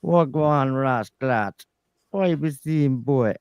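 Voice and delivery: slow, drawn-out Jamaican patois; Yardie accent. Pretend. Podcast guest asked to do a Yardie, mock Jamaican accent. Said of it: "Can you do a Yardie accent?".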